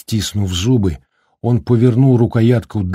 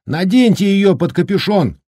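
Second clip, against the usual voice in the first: высокий крик сквозь ветер; крикнуть указание через вьюгу; прокричал; 10 semitones higher than usual. Acted. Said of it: "прокричал он". (громкий крик на ветру)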